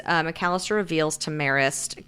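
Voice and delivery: ordinary talk, nothing marked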